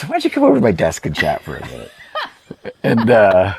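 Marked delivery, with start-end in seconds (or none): 0.0-1.9 quoting the teacher through a laugh, higher and louder, fast; laughing quote. Retold or imitated. Art student retelling his teacher's invite, laughing. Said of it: "And he goes".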